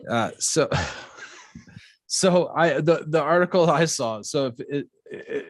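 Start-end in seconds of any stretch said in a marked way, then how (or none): none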